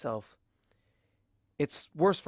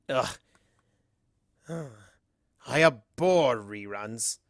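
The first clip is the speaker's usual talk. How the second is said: slow, louder disdainful drawl; bored disdain; 3 dB louder, slower than usual. Acted. Discord dismissing the replay, slow and disdainful. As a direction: sneer at the repetition. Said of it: "says Discord".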